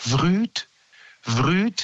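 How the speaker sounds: drawn-out rising chirp; slow upward-gliding bird call. Retold or imitated. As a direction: imitate a gentle chaffinch note sliding up. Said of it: "klingt heller, weicher".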